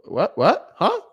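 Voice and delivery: rising, incredulous little questions; mock astonishment. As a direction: play the baffled friend, each question tipping upward. Friends reacting to his success.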